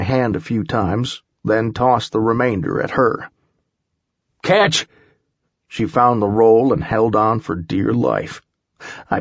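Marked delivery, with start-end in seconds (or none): 4.4-4.9 raised high call. A narrator's reading. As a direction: call it across the pit. Tossing cord to Holly.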